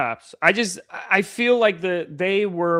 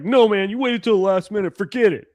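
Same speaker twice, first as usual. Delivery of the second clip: annoyed buddy; 4 semitones higher than usual. Retold or imitated. Friends mad at late trade.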